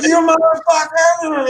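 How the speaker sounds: high, drawn-out yell of pain; slow, high, loud cry of pain; pained scream. Retold or imitated. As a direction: scream it as the water hits the nerve. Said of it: "I'm screaming and shit".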